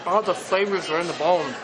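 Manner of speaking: talking while chewing; mouth full. Real, not acted. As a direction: speak through a mouthful. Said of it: "I'm not supposed to talk with my mouth full".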